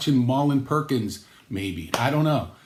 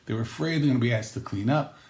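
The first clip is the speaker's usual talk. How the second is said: ordinary talk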